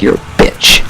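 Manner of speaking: breathy whisper; whispered insult. Retold or imitated. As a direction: hiss it under the breath. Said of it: "just be like".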